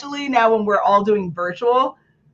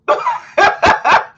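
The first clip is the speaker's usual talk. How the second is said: high laugh; 4 dB louder than usual. Real, not acted. High laugh after mocking a nervous speaker.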